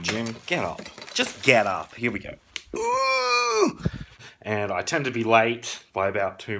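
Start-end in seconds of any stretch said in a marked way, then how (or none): 2.8-3.8 long, very high "ooh"; sustained falsetto whoop. Real, not acted. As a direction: let out a high-pitched squealed whoop. High whoop before setting off.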